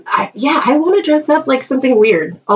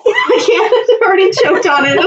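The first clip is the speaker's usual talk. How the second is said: ordinary delivery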